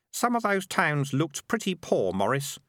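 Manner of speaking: put-on unsure young voice, lifting at the end; doubtful. A narrator's reading. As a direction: raise a hesitant doubt as a boy. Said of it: "said the kid doubtfully".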